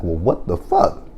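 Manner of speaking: slow stunned disbelief. Retold or imitated. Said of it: "I'm like".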